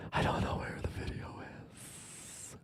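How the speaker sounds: slow, barely voiced whisper; hushed, trailing self-talk. Real, not acted. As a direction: whisper it to yourself while searching. Searching, muttering to self.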